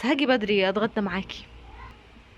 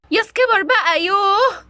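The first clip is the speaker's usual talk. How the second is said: high, loud whining plea; whiny complaint; 7 semitones higher, 6 dB louder, slower than usual. Acted. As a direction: whine his name like a spoiled wife. Wife whining at husband. (نبرة زن عالية)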